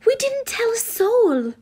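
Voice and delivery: high, loud, desperate whine; whimpering. A narrator's reading. Student denies it to angry fathers, whimpering. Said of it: "I whimpered".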